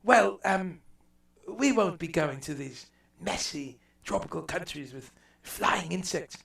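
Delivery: posh imperial English lord, dismissive; lightly voiced aristocrat planning the colonies; mock English colonial official. English lord to his council, lightly mocking. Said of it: "they looked around and they said".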